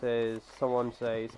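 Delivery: usual speaking voice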